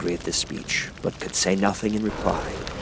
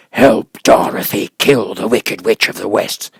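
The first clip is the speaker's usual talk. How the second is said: beast voice; 7 dB louder than usual. Acted. Beast commands.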